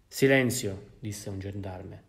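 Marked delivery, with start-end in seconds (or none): 0.1-0.9 loud barked order; gendarme's command. Acted. Gendarme silences Jean Valjean, loud stern command. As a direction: shut him up sharply.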